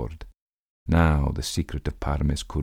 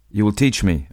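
higher, louder pleading cry; desperate cry; 5 semitones higher, 5 dB louder than usual. Acted. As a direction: cry out, begging. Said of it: "I cried".

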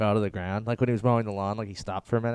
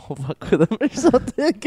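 laughing mock prank; 10 semitones higher, 6 dB louder than usual. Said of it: "we'll be like".

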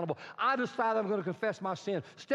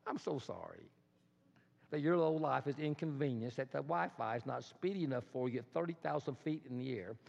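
low, soft sarcastic pity; mock sympathy; 7 semitones lower, 7 dB quieter than usual. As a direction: drop low and quiet, feigning sorrow. Mock sympathy for petty complainer.

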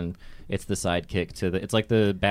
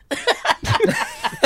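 squealing laugh; high laugh; 4 dB louder than usual. Real, not acted. Laughs at own bit.

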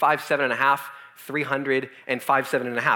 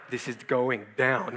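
lower, softer, very slow dread; ominous whisper; 6 semitones lower, 6 dB quieter, much slower than usual. Retold or imitated. Teen watching his sick buddy dive in, slow ominous hush. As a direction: savor the coming disaster.

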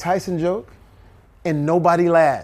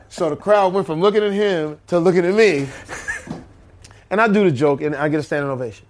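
usual speaking voice

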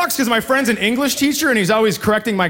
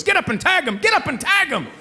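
higher, yelled coaching call; mock coach yell; 7 semitones higher than usual. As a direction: bark it like an eager little-league parent. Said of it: "just goes".

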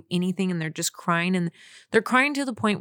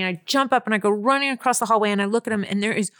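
usual speaking voice

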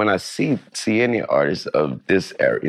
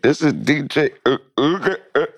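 higher-pitched announcer stumbling over a name; mock commentator fumbling, voice pitched up; scrambling commentator; 9 semitones higher than usual. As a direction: imitate a flustered commentator, tripping on the name. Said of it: "the commentator scrambling and like".

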